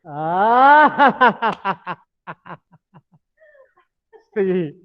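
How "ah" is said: laughing ah. Shown as a demonstration. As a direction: laugh on an open ah. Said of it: "Again, this hand".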